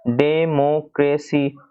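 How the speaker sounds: Democracy very slowly as "डे - मो - क्रे - सी"; four-syllable demonstration. Shown as a demonstration. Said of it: "four syllables है".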